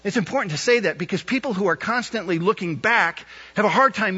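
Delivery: rising, ringing pulpit voice; impassioned sermon delivery; preaching at full force. Acted. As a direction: build it up and preach hard.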